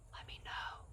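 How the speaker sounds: barely voiced whisper of let me know; whispered repeat. Real, not acted. Host whispers her plea to viewers. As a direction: breathe the words out.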